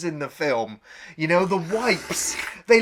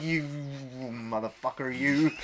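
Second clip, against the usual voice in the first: seething; 4 semitones lower, 7 dB quieter than usual. Retold or imitated. Acts out actress's anger, held back.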